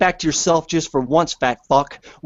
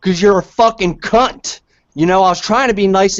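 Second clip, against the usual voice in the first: loud, raised angry insults; furious yelling; 5 semitones higher, 4 dB louder than usual. Real, not acted. Ranting at the podcast caller, furious and loud. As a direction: yell it right in his face.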